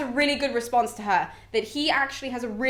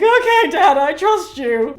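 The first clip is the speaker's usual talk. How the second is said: much higher and louder, mock-weepy daughter; a sniffly okay to Dad; hammed-up crying goddess; teary Venus; 6 semitones higher, 10 dB louder, slower than usual. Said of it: "Venus is still crying and she's just like".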